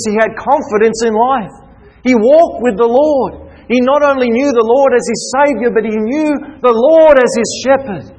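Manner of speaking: rally the brethren against fear; rousing preaching. Acted. Climax of sermon.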